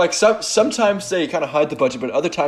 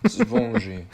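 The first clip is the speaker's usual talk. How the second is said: put-on French accent; 8 semitones higher, 5 dB quieter than usual. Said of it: "I was saying spongy".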